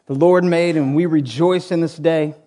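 low, hushed prayer voice; opening prayer. Real, not acted.

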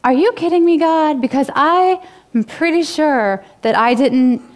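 high, disbelieving cry to God; are you kidding me, voice jumping up; pitched high with shock and indignation; incredulous outburst. Retold or imitated. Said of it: "I would be like".

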